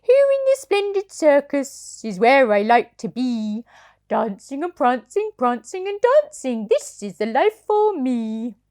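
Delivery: a contented toy horse singing his joy; rocking horse voice. Acted. Parent reading to child as happy rocking horse. Sugar Lump speaks.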